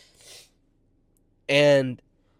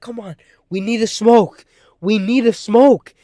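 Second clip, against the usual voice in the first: higher, louder voice of someone pressing; imitated insistent urging; 7 semitones higher, 11 dB louder than usual. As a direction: mimic her pushing, leaning hard on the repeat. Imitates woman insisting on smoking.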